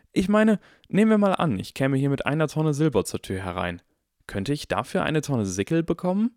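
put-on keen child reasoning aloud; eager inquisitive boy. A narrator's reading. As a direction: pitch a hypothetical as a curious Harry.

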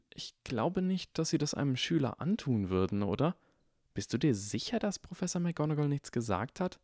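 gently doubting mother; mother's voice. Acted. Mother's voice, soft and high. (besorgte Mutter, höher und leiser)

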